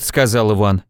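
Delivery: ordinary delivery, nothing marked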